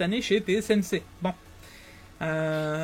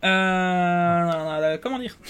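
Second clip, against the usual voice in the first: normal talking voice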